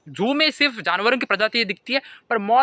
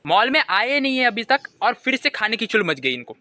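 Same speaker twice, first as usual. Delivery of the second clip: ordinary talk, nothing marked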